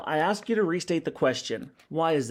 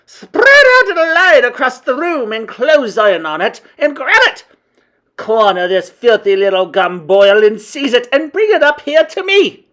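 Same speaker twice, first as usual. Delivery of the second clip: very loud, high-pitched tyrant's shriek; screamed witch commands; 10 semitones higher, 13 dB louder than usual. A narrator's reading. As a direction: scream orders across a ballroom. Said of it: "screamed the Grand High Witch".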